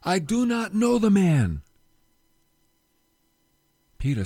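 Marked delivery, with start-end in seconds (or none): 0.0-1.6 loud, slow, emphatic denial; cursing denial. Acted. Peter swearing at the bystanders, slow and loud. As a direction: spit out each word with force. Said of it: "Then he began to curse and swear".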